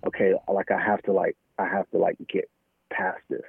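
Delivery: ordinary talk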